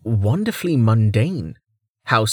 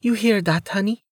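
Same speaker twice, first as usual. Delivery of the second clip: high, teasing female character voice; playful, pitched-up aside to a sweetheart; 10 semitones higher than usual. A narrator's reading. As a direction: tease your partner in a high, playful voice. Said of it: "Amelia asked playfully".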